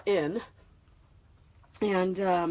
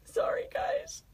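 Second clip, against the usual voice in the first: barely voiced, wiping tears away; teary whisper; slower than usual. Real, not acted. Apologizing to online viewers while crying, whispered.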